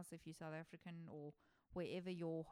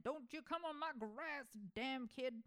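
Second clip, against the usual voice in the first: put-on cranky old man yelling at kids, pitched higher; grumpy old man; 6 semitones higher than usual. A hermit shouting at trespassers, grumpy old man voice. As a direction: shoo the kid off your lawn, grumbling. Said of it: "that classic old man".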